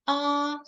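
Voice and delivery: high level first tone ah. Shown as a demonstration. Teacher demonstrating the first tone, high and level. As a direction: hold ah high and flat. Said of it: "the first tone is".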